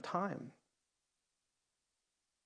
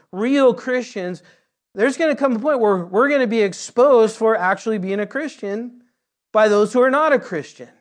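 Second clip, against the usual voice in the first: loud, high, driving sermon voice; rising preacher's fervour; preaching at full force; 4 semitones higher, 5 dB louder than usual. Acted. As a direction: build it up and hammer the point. Preaching climax about hiding.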